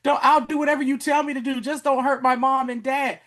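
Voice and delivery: high-pitched mock child begging; acted kid freaking out; pleading kid. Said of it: "and kids be like".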